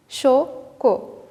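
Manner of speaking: "Choco" as two separated beats, cho, co; syllable by syllable. Shown as a demonstration. Teacher points out O at start and middle, syllable by syllable. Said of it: "et au milieu".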